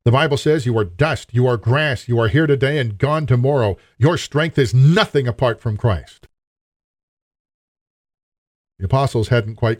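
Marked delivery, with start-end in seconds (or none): none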